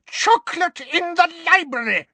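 very high-pitched, scandalized screech; screamed. A narrator's reading. Librarian enraged at chocolate in her library, screaming. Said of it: "she screamed".

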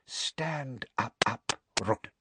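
soft, cold whisper. A narrator's reading. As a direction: hiss it quietly. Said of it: "whispered Harry".

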